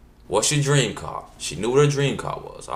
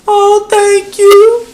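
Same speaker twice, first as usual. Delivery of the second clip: wailing thanks; 19 dB louder, much slower than usual. Retold or imitated. Mother crying thanks.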